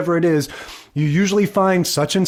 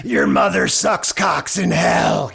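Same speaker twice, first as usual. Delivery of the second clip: slow, gravelly demonic growl; gravelly voiced old guy; 3 semitones higher than usual. Quoting The Exorcist to co-host, gravelly old man voice. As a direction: imitate a rasping old man possessing a girl. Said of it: "some gravelly voiced old guy going".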